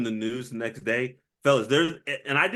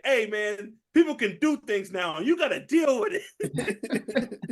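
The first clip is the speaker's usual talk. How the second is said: higher, drawn-out movie quote, laughing by the end; Danny Trejo; 7 semitones higher than usual. Retold or imitated. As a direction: quote the bartender's advice, slowing on the last line. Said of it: "'Cause it's Danny Trejo".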